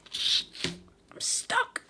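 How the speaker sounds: high breathy whisper; whispered creepy line. Retold or imitated. Streamer echoing a game voice in a high whisper. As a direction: whisper it like a trapped ghost.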